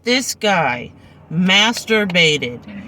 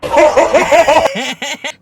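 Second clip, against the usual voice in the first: loud laugh; 9 semitones higher, 7 dB louder than usual. Real, not acted. Laughing at the memory.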